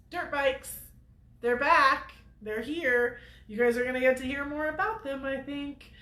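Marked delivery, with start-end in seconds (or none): none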